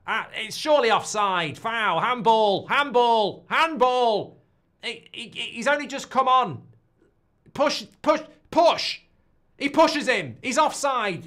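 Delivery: high, loud protesting shouts; indignant appeal. Real, not acted. Fan appealing for handball or offside, indignant shouting. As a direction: yell for the decision, insistently. Appealing to referee against the goal.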